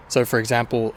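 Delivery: normal talking voice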